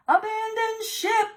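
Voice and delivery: high, loud panicked order; panicked command. Acted. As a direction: shriek the order to flee. Rogue captain panics.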